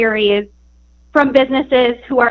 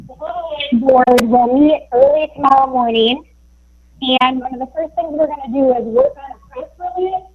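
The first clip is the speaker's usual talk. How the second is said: cutting in and out; 2 semitones higher than usual. Real, not acted. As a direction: voice dropping out on a bad connection. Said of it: "your voice is cutting in and out".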